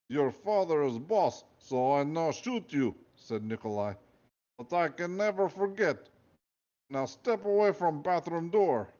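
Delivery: slow, menacing Russian guard; Russian accent. Acted. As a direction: order her aside in a Russian accent. Nikolai orders Elephant.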